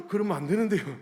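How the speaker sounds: sharp gasp, then high, loud dismay; startled objection. Retold or imitated. District office caller hearing four thousand attend, startled objection. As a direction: gasp and protest in alarm.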